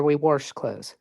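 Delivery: "wash" said with an r, like worsh; local wash. Shown as a demonstration. Caller showing her local wash as worsh. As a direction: put an r in wash.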